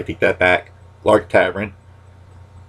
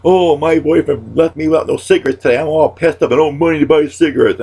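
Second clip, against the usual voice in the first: whiny complainer; 7 semitones higher, 3 dB louder than usual. Retold or imitated. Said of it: "they're saying".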